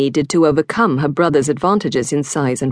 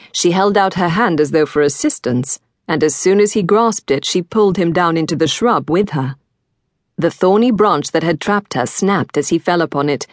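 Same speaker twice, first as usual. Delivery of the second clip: ordinary delivery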